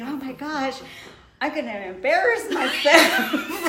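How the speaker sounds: high, loud laughing inner panic; mock-panicked laughing thought. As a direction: laugh through the embarrassed dread. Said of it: "and I'm thinking".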